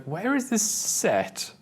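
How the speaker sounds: mock confused question; imitating fiancée. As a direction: slowly puzzle over where the film is set. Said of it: "she was like".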